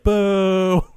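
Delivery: high, long held boo; imitated booing. Retelling the other room joining in, long high mock boo. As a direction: boo like a crowd next door. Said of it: "other room was like".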